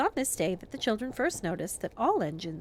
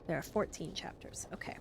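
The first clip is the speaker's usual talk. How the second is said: hushed aside; 11 dB quieter than usual. Real, not acted. Reader's aside counting chapters.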